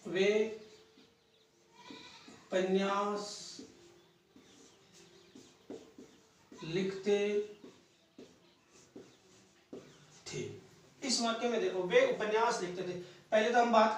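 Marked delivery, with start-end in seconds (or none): 0.0-10.4 word by word with long pauses; slow, widely spaced words; dictation pace. Real, not acted. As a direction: dictate slowly as if writing it out. Writing out example word by word.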